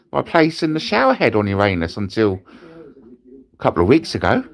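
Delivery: ordinary talk, nothing marked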